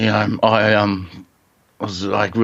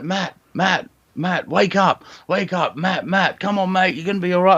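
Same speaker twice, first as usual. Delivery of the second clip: rescuers calling his name; 9 semitones higher than usual. Retold or imitated. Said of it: "I'm like".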